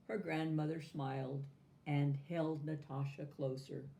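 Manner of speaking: ordinary delivery, nothing marked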